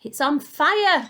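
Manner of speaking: high, loud alarmed yell. A narrator's reading. Grandma seeing smoke from the hen, a loud slow yell. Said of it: "Grandma yelled".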